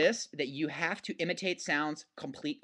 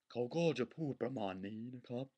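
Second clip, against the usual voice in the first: softer Thai film-narrator delivery; cool narrator voice; 3 semitones lower, 8 dB quieter, much faster than usual. Shown as a demonstration. Teacher mimicking the narrator in Thai films, cool voice. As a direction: narrate it softly, trying to sound cool. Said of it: "talking like this".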